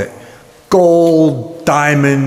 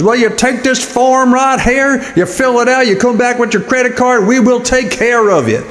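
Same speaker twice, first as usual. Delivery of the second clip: mocking high voice; 11 semitones higher than usual. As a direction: copy his squeaky voice back at him. Clerk mimics high voice back.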